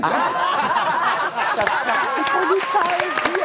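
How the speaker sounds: high, slow, laughing squeal; laughing in disbelief. Real, not acted. Reading how the police addressed him, squealing with laughter. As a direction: break into high, amused laughter. Police letter calls her Excellency.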